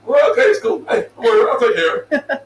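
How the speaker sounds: high, laughing mock-cheerful voice; breezy coworker. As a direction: play the carefree guy brushing it off. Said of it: "He's like".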